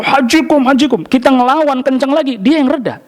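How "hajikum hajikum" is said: imitating a guard yelling at pilgrims; half-hearted retold shout; mock yell. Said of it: "yang teriak-teriak itu".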